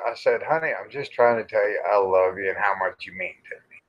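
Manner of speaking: ordinary talk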